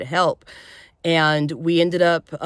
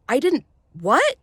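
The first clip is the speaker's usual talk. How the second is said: imitating stunned disbelief; 9 semitones higher than usual. Retold disbelief at accusation.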